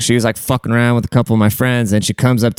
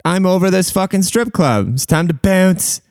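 mock exasperated, pitched way up; high-pitched exasperation; 7 semitones higher than usual. Giving up on the persistent stripper, high-pitched exasperation. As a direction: act out being done, voice jumping high. Acting out his exasperated exit.

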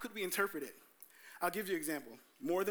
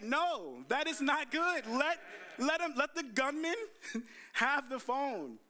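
exasperated rebuke; 8 semitones higher, slower than usual. Real, not acted. Older brother scolding him for chasing a gunman, exasperated.

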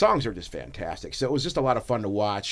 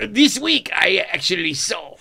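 much higher, hammed-up French accent; mock French accent; 7 semitones higher, 4 dB louder, slower than usual. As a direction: clown it up as a Frenchman. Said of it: "You fucking frog sissy".

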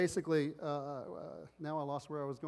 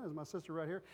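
normal talking voice

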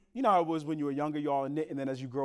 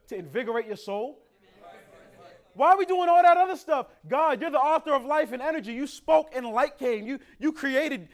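loud, high preaching build with shouted peaks; climbing from fast urgency to a big shout; full-force preaching; 9 semitones higher, 7 dB louder than usual. Acted. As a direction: preach it to the rafters.

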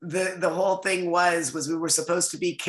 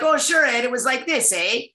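put-on high, eager Canadian accent with a tagged-on "eh"; mock Canadian coaching voice, higher than usual; 6 semitones higher, 3 dB louder than usual. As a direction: demonstrate a cheery Canadian accent, pitched up. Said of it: "how to do the Canadian accent".

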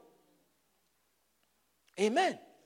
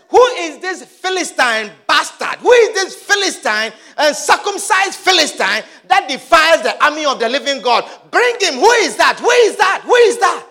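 loud, high, outraged challenge; acted fury building to shouts; angry David; 7 semitones higher, 11 dB louder than usual. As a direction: roar the challenge like an angry warrior. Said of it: "He was angry".

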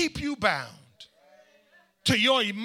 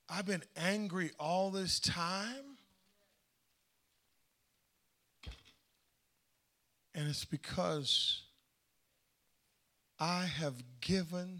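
slow, much softer; slow quiet reflection; 2 semitones lower, 6 dB quieter, slower than usual. Real, not acted. Preacher to congregation, slow and soft realization. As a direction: let it sink in slowly and softly.

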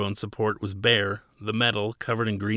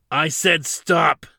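very slow, higher and louder yell; 6 semitones higher, 7 dB louder, much slower than usual. A narrator's reading. Officer yelling at advancing Zadeh, slow loud yell. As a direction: shout the order, each word hammered. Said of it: "he yelled".

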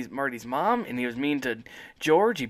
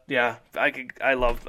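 ordinary delivery

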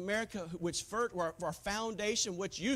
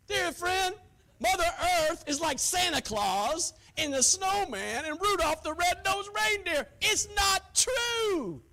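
shouted, raised-pitch sermon rebuke; thundering pulpit denial; full-force preaching; 7 semitones higher, 5 dB louder than usual. Real, not acted. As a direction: preach it loud, stretching out the final words.

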